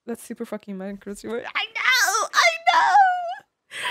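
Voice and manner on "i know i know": high-pitched, wailing exclamation; overwhelmed high shout; worked-up shout. Real, not acted. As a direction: cry it out loudly, way up high. Worked up over a stressful match.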